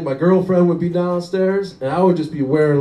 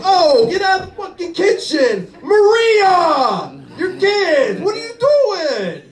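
much higher, yelling Italian father; furious Italian dad; 13 semitones higher, slower than usual. Retold or imitated. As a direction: explode like a hot-tempered Italian dad. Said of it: "the more they sound like an Italian".

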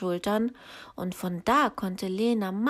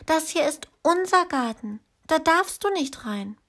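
put-on bossy little girl; 8 semitones higher than usual. A narrator's reading. Lena tells giant off.

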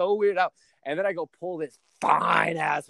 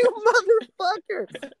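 much higher, slow and laughing; laughing mock insult; 3 dB louder, slower than usual. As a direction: drag it out through laughter.

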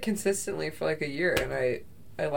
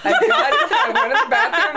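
giggling through the story; laughing; 12 semitones higher than usual. Real, not acted. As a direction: talk while cracking up. Telling a gross story laughing.

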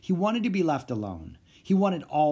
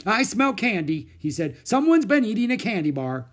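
loud accusing; 9 semitones higher, 6 dB louder than usual. Acted. Doctor sniffs out candy.

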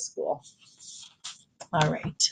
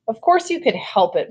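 mildly scolding, raised pitch; a touch of motherly reproach, pitched up; scolding mom; 5 semitones higher than usual. A narrator's reading. Mom to Jamie about running from the boy, mildly scolding. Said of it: "Mom scolded".

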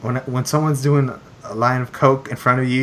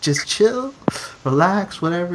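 higher, pleading calm-down; telling a partygoer to chill, pitched up and pleading; exasperated calming; 6 semitones higher than usual. Retold or imitated. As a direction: urge him to chill, pitched up. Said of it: "I'm like".

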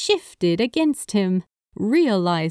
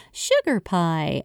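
plain reading voice

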